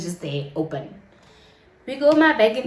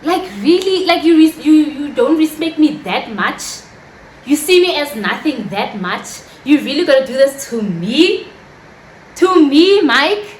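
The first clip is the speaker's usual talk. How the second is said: loud, raised-pitch incredulous questions; indignant disbelief; 3 semitones higher, 6 dB louder than usual. Acted. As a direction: confront him, hurt and disbelieving. Acted confrontation with Mike.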